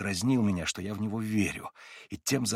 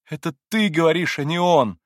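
louder and higher, grieving outcry; sorrowful exclamation; 6 semitones higher, 7 dB louder than usual. A narrator's reading. Alyosha exclaiming to Ivan in sorrow, louder. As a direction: exclaim it in grief. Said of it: "горестно воскликнул Алёша".

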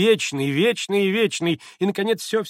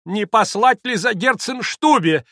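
высоко, громко и медленно; всплеснуть испуганным вопросом; восклицание; 10 semitones higher, 8 dB louder, slower than usual. Acted. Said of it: "воскликнула было госпожа Хохлакова". (взволнованно воскликнув)

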